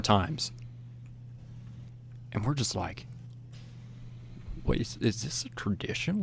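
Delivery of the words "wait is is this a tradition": halting near-whisper, imitating puzzled onlookers; softly puzzled, under the breath; hushed confusion. The group wondering about the yelling, hushed and confused.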